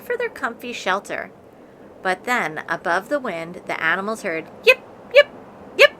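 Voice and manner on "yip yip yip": high loud dog yips; yipping huskies. A narrator's reading. Husky team yipping as they barrel in. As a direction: bark out sharp yips.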